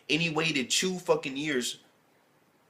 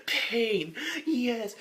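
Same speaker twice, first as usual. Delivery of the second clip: high, relishing, hammed-up groan of pleasure; mock ecstatic villain; 11 semitones higher than usual. Mocking the anime villain for the viewers, high and hammy. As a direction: ham it up like a weirdo savoring pain. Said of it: "He's like".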